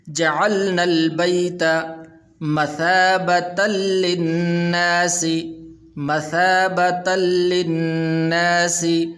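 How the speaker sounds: slow sung tajweed recitation; Quran recitation. Shown as a demonstration. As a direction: chant each word long and melodic. (ترتیل کے ساتھ تلاوت)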